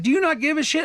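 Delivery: high, sharp, accusing; retold angry outburst. Caller accusing the bank agent, high and angry. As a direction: challenge her, fed up.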